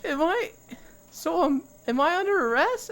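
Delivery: very high, hesitant imitation; bewildered prisoner. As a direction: ask haltingly in a high, confused voice. Retold confused prisoner asks if arrested.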